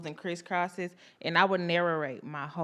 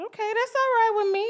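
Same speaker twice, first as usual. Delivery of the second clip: high, pleased okay; 3 dB louder than usual. Retold or imitated. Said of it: "I said".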